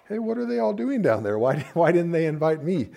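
mock hurt God. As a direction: play God sulking at not being invited. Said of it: "It's not as if God was like".

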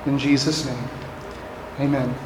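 soft, low, slow closing prayer; hushed, measured pulpit prayer. Acted. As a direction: pray quietly, letting each phrase settle.

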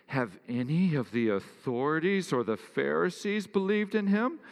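high and loud, climbing to a haughty peak on "in him"; lofty sneer of the proud elite; pretentious voice. Acted. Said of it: "look at this pretension".

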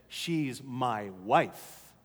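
low, very slow, weighted reply; firm father. Retold or imitated. Father setting his adolescent son straight, firm.

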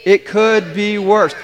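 firm rallying call; say it like you mean it. Shown as a demonstration. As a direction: declare it with conviction. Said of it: "say it like you mean it".

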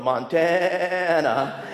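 Montana stretched very slowly, each syllable drawn long; teasing a seminary friend, slow mocking Montana. Shown as a demonstration. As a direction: drag out Montana like an old joke. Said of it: "as we used to make fun of him".

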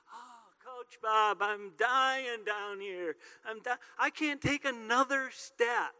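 put-on high, slow, gasping kid's whine; whiny out-of-breath kid. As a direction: play a worn-out little kid begging the coach. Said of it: "they'd go like".

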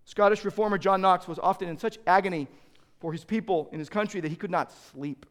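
ordinary delivery

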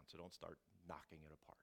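soft, breathy aside; whispered aside. Acted. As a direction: drop it as a quick whisper.

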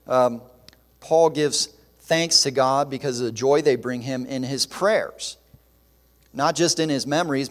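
normal talking voice

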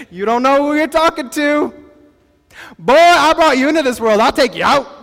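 playful telling-off with a laugh in it; mock going off. Pastor imagining the Lord threatening Abram, mock outrage. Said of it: "He could have went off".

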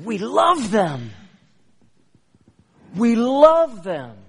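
loud, high, drawn-out insistence; big, emphatic reassurance; emphatic declaration. Retold or imitated. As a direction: proclaim it slowly with a soaring stress on love. Emphatic retold answer to her question.

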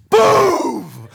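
loud imitated boom; mock whack sound. Telling how his dad whipped him, loud imitated boom. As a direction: punch out the sound of the stick hitting. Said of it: "And he's like".

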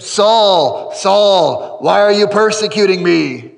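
loud, commanding voice from heaven; calling out. Acted. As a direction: call Saul out with booming force, drawing out the name. Acting the heavenly voice calling Saul.

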